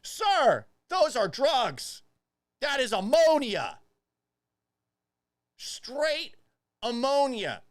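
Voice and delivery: loud, high stern correction; hammed-up loud scolding; mock scolding. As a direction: lecture him loudly, stressing ammonia. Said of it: "accent mode".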